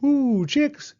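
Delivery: high loud call. Acted. Owl calling out to the peeping chicks. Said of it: "called Owl".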